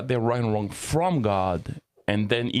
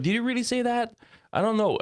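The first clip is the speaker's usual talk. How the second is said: much higher, sneering tempter voice; sly serpent; 12 semitones higher than usual. Retold or imitated. Playing the serpent tempting Eve, in a much higher sly voice. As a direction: needle her with mock doubt, way up high. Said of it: "he's gonna question his word".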